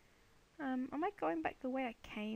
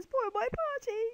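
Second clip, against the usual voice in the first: high, loud pretend wail; mock crying whine; 10 semitones higher, 6 dB louder than usual. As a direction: wail it like a hammed-up sulk. Mock complaint about the creepers.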